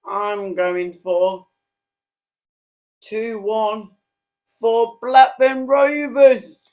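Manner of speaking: dramatic build-up. Acted. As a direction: build it up to a big finish. Building up to a big prediction.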